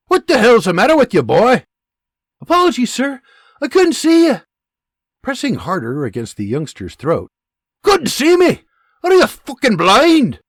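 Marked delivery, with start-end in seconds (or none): 0.1-1.6 loud, fast, furious soldier; angry bark. Acted. Soldier pinning a boy, barking in his face. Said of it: "he angrily barks in Robert's face". 2.4-4.4 high, loud pleading youngster; frightened boy. Acted. Pinned teen apologising to a soldier, high and strained. 7.8-10.4 high, loud, sneering yell; furious soldier. Acted. Soldier yelling at the boy he pins, furious.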